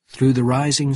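ordinary delivery

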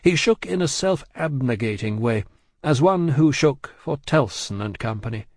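usual speaking voice

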